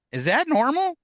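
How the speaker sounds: high, rising, unsettled query; retold uneasy surprise, voice lifting sharply; alarmed question. Alarmed at towering candle flames.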